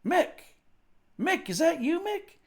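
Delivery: put-on suddenly recognize an old friend; surprised recognition. Border guard recognizing his college friend, high surprised. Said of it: "he goes".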